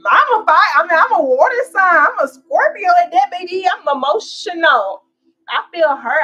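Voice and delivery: high, loud, sassy Scorpio warning; loud, high, playful warning to her partner; fired-up rant. Real, not acted. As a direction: preach it to your partner, all heat.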